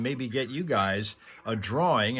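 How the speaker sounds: normal talking voice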